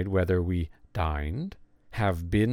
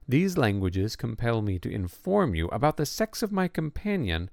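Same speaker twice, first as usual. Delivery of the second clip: ordinary delivery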